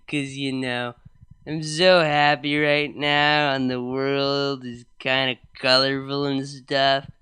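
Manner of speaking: loud, slow, giddy drugged rambling; drunk rambling. Acted.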